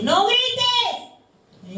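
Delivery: high, yelled no grites; mock shout. Preacher imitating a parent yelling at a child, mock shout. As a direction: snap at the kid like a parent.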